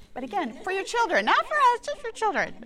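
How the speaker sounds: high, teasing emphasis; wry irony. Real, not acted. Talking to the group, mock-pointed about children. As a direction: poke fun at herself lightly. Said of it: "ironic aside".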